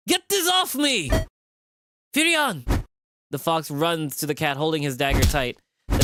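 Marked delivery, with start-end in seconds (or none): 0.1-1.1 very high, slow panicked plea; panicked cry. Acted. Cat pinned under a monster, panicking. 2.1-2.6 calling out. Acted. Fox cries out for the cat.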